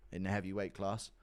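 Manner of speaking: usual speaking voice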